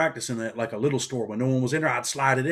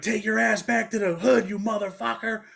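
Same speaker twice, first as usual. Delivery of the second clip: higher, slow, drawn-out mock yell; parting insult yelled; 9 semitones higher than usual. Driver shouting an insult at him while driving away, slow higher mock yell. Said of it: "he's like".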